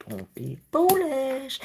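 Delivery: high, slow, sing-song mock warning; mock scolding. Repeated warning in put-on voice.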